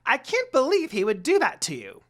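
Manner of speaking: very high, loud squawky grumble; angry complaint. A narrator's reading. Jokin glaring around, loudly complaining. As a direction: complain like an outraged imp.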